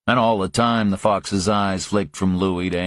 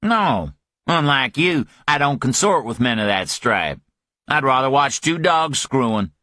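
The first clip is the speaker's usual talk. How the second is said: higher, scornful retort; disgusted scorn; 7 semitones higher than usual. Acted. Griffin scorning Parker, higher and disgusted. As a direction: spit it out in disgust.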